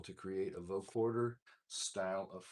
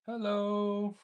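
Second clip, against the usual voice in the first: pedal effect; 6 semitones higher, 7 dB louder, much slower than usual. Real, not acted. Testing mic through the pedal.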